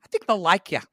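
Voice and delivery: breezy producer waving away her doubts; lightly voiced producer brushing it off; producer [name].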